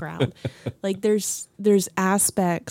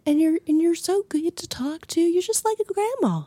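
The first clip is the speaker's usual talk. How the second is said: coworker's voice; 10 semitones higher than usual. Retold or imitated. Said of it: "Because she's like".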